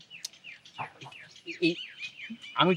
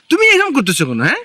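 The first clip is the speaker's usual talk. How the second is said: loud, raised Bengali protest; exasperated outburst; 5 semitones higher, 5 dB louder than usual. Acted. Husband demanding why wife behaves so, exasperated. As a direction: burst out in frustration.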